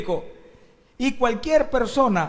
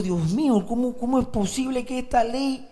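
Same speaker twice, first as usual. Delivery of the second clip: raised, disbelieving exclamation; incredulous dismay; 4 semitones higher than usual. Acted. Preacher reacting to a law that passed, incredulous. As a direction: ask in exasperated disbelief.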